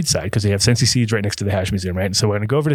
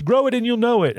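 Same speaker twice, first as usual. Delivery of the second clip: higher, sing-song sales line; mock sales pitch; 12 semitones higher than usual. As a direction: parrot his one pitch, mocking. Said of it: "his only sales pitch".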